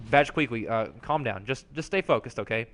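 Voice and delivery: low, soft, hesitant reassurance; gentle hushed urging; calming down. Acted. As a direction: quietly talk someone down from a rant. Other character calms the ranter down.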